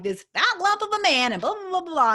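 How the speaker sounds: high, loud, hammed-up tirade; diva rant. Telling Sam the story in a hilarious way, diva rant. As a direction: go off like a comic diva. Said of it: "diva it out".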